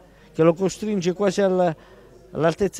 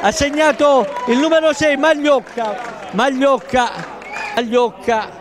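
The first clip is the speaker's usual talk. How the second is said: excited goal call; 5 semitones higher than usual. Real, not acted. Goal scorer called out.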